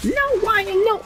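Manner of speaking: very high mock cry of protest; put-on high-pitched kid crying out; Garrett. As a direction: squeal it as an imitated plea. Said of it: "and he's like".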